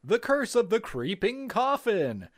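booming TV promo announcer. Pretend. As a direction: sell it like a fighting game announcer. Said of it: "you have the best, like, announcer voice".

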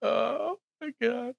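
high, laughing oh my God. Real, not acted. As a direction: squeak it out through laughter. After laughing at the guess.

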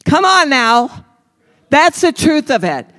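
high, loud preacher's mock of the blamer; preaching loud. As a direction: push it up and out to the congregation. Preacher mocks self-justifying voice, then exhorts.

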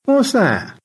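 gracious high thanks; George. Acted. George thanks both rescuers, genteel high voice.

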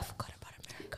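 fast unvoiced whisper to herself; a hurried whispered aside. Real, not acted. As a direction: breathe it as a quick aside. Aside under her breath.

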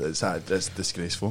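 usual speaking voice

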